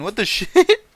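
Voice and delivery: normal talking voice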